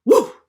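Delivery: loud sharp woof; dog bark. A narrator's reading. The dog agreeing to join, a loud woof. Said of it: "said the dog".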